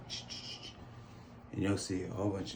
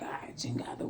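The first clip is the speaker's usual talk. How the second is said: hushed cussing; 3 semitones higher, 7 dB quieter than usual. Retold or imitated. Waking up to mom slapping the tattoo, whispered cuss. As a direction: mutter the curse under your breath. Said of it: "We both cussing in Spanish".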